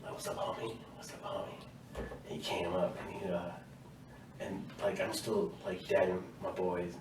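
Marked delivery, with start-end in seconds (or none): 0.1-1.5 breathy whispered hey homie; whispered greeting. Retold or imitated. Recruit whispering to sergeant in chow line, hushed whisper. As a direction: whisper it under your breath.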